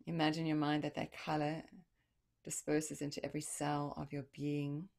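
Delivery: calm visualization voice; guided meditation. Acted.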